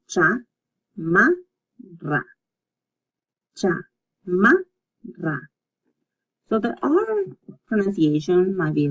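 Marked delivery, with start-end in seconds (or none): 0.1-1.4 "chamarra" slowly as cha, ma, rra, trilled R; syllable by syllable. Shown as a demonstration. Teacher drilling the double R, "chamarra" in separate syllables. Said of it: "you separate the two". 3.6-5.4 "Chamarra" very slowly as cha, ma, rra; long gaps inside "Chamarra", ending on a trilled rra; syllable by syllable. Shown as a demonstration. Teacher repeating for practice, "Chamarra" broken into syllables. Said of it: "you separate the two".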